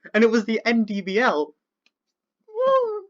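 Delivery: high whooping celebration; elated cheer. Real, not acted. Celebrating the win.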